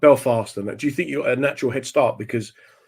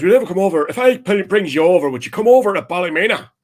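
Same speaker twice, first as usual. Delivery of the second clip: loud, high Northern Irish impression; Northern Irish accent; 7 semitones higher, 5 dB louder, faster than usual. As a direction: play an insistent Ballymena man with broad accent. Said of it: "this guy says to me".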